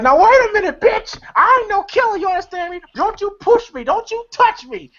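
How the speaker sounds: very high-pitched frantic warning; hammed-up squealing protest, pitch way up; mock outrage. Someone being pushed aside on the corner, high mock fury. Retelling a scuffle with old ladies.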